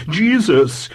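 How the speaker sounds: high, sharp vicar's snap; snapping. A narrator's reading. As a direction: cut the child short irritably. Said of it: "snapped the vicar".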